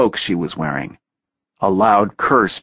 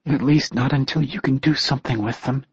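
trembling voice; 6 semitones higher, 3 dB quieter than usual. A narrator's reading. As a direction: voice trembling, higher pitch. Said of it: "she added in a trembling voice".

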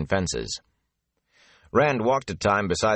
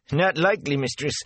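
ordinary delivery

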